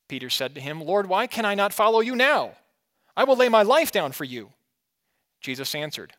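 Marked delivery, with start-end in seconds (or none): 0.8-4.5 higher, louder earnest pleading; impassioned protest. A narrator's reading. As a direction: protest boldly as a devoted follower. Reading Peter's bold protest to Jesus.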